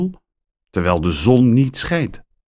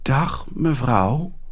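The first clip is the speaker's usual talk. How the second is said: verlegen; 6 semitones higher, slower than usual. Acted. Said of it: "zegt Max verlegen".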